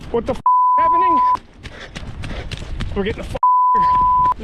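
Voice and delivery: high, frantic shout; frantic to get away; panicked shouting. Real, not acted. Panicked fleeing.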